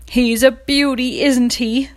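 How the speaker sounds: lightly voiced farmer showing off the bird; a hint of farmer's pride; proud farmer. A narrator's reading. Farmer showing Horse the new rooster, lightly proud. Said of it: "the farmer said".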